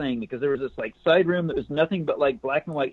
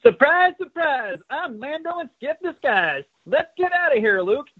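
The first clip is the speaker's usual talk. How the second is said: put-on high, gleeful kid hero voice; triumphant hero; 10 semitones higher, 5 dB louder than usual. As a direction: announce the reveal with triumph. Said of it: "declares triumphantly".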